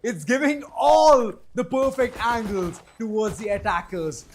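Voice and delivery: emphatic esports commentary; excited play-by-play. Real, not acted. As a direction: hype up the attack as it happens. Live call of the attack.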